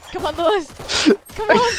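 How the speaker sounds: excited cheering. Real, not acted. Teammate cheers Lewis on in his last-player clutch, excited shout. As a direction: yell him through the clutch.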